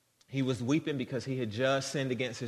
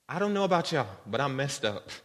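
usual speaking voice

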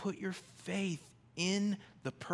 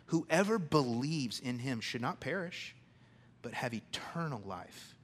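ordinary talk

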